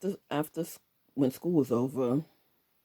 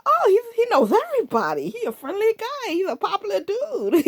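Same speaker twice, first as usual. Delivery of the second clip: naive mock cheer; 5 dB louder than usual. Naive younger self explaining.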